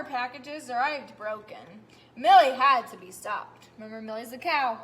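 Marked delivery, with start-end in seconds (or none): 2.2-3.7 loud emphatic reading; teacher reading the stern line loudly; dramatic. Acted. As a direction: hammer it home.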